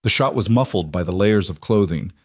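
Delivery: plain reading voice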